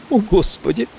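high shocked cry; horrified at what she has done; cry of dismay. A narrator's reading. Maggie seeing his blood, high shocked cry. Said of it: "вскрикнула она".

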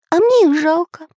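put-on high pitying boy voice; Pencil's voice. A narrator's reading. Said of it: "сказал Карандаш".

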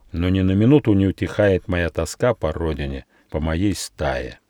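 usual speaking voice

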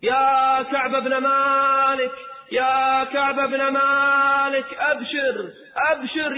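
high, long-drawn calls of the name; singing out glad tidings; shouting good news. Acted. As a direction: hail him from a distant hilltop. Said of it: "وصرخ بأعلى صوته".

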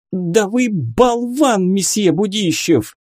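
high, drawn-out indignant insult; exasperated young lady, pitched high; angry outburst. A narrator's reading. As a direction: blurt it in his face. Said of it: "Выпалив в лицо Дмитрию всё".

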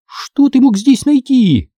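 high, slow, sceptical tease; mildly scoffing. A narrator's reading. Sister doubting her brother's book of magic, scoffing. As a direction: scoff lightly, drawing it out up high. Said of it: "усмехнулась я".

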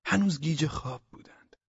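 ordinary delivery

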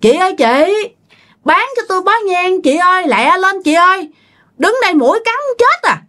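high, impatient call to a vendor; calling customer. Acted. Woman on the bank urging the incense seller to hurry, high-pitched calling.

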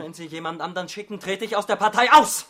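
fly into a rage at the end; angry outburst. Acted. Hugo threatening Olga to quit the party, shouting.

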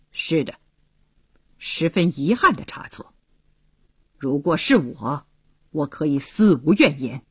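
low, measured and resolute; a colonel's cold pride, pitched low; firm, proud and cold. A narrator's reading. Colonel answering Philip's plea for the soldier, firm and cold. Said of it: "坚定地说".

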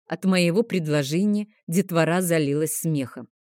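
ordinary delivery

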